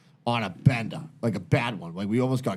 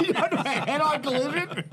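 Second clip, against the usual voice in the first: higher pitched, laughing as he tells it; 13 semitones higher than usual. Real, not acted. Telling friends about the crash, laughing. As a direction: giggle through the punchline.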